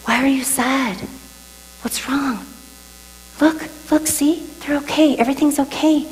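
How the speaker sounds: imploring God. Retold or imitated.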